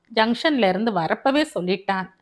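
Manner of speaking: ordinary delivery, nothing marked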